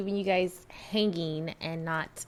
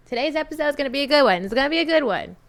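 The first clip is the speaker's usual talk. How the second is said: fast, higher, bright announcement; excited; 6 semitones higher, 4 dB louder, much faster than usual. Real, not acted. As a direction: hype up the episode. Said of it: "I'm excited for it".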